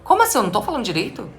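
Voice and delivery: very high-pitched mock disbelief; baffled child. Child thinking after being corrected, acted bafflement. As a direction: act out a child thinking in shock. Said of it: "o cérebro tá pensante".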